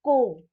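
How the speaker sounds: 'को' loud, with a held o; demonstrated syllable. Shown as a demonstration. Teacher modelling the sound for learners, 'को' loud and isolated. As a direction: sound out 'को' strongly on its own. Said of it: "इस तरह से इसका उच्चारण होगा".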